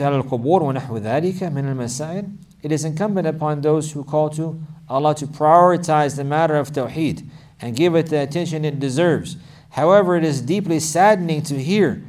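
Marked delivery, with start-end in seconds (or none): none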